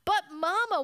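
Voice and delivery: put-on high-pitched child's whine; whining. A narrator's reading. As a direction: whine like a little cub pleading with Mama. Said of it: "whined sister".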